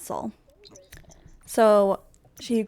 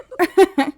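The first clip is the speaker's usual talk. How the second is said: sudden loud high burst of laughter; real laugh; 10 semitones higher, 9 dB louder than usual. Real, not acted. Laughing at the Baron's makeover, a loud burst of laughter. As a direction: crack up at the Baron's new look.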